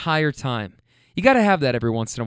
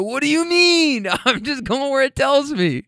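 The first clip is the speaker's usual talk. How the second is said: mock exasperation; 11 semitones higher than usual. Driver roasted by granny, high mock exasperation. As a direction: act out helpless protest, pitched way up.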